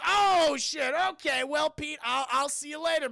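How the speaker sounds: loud, high mock alarm; hammed-up nervous goodbye; startled retreat. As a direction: cry out startled, then make awkward excuses to leave. Shaun startled by zombie Pete.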